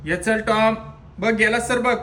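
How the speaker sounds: high, loud urgent call; calling from far away. Real, not acted. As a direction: shout for the dog to hurry. (घाईने मोठी हाक)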